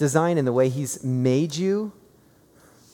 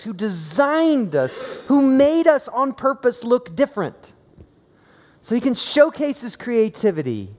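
high, loud, driving sermon delivery; impassioned preaching; 10 semitones higher, 5 dB louder than usual. Real, not acted. Preacher telling congregation God made them good, impassioned. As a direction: proclaim it to the whole congregation with force.

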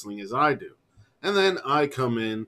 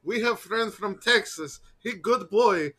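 high-pitched broken English voice; broken English impression; 9 semitones higher than usual. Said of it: "doing an impression".

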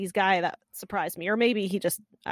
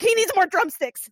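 high, raised, urgent call-out; mock panicked call; 9 semitones higher, 5 dB louder than usual. Co-host acting out a woman calling to the kitchen, panicking. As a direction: imitate a flustered shout to the kitchen.

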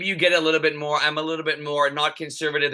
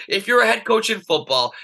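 heated; 6 semitones higher, 3 dB louder than usual. Real, not acted. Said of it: "I'm adding a little bit of juice to this".

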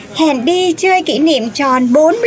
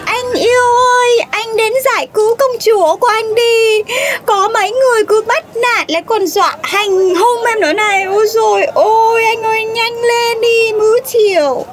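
whiny plea for rescue. Acted. Girlfriend begs her boyfriend to rescue his princess, whining. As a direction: whine to your darling to come save you.

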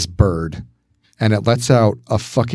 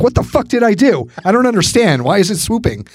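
retold yelling; 9 semitones higher than usual. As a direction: yell at a bird in retelling, voice pitched up. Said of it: "I'm yelling at the bird".

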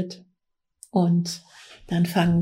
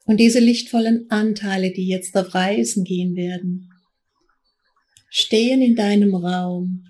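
guided meditation. Acted. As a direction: guide them gently into trance. Leads a guided trance journey.

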